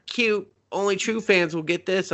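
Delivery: high, giggly imitation of pleased insiders; mock in-joke delight. Filmmakers winking at their true fans, mock delight. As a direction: put on a high, self-pleased little voice.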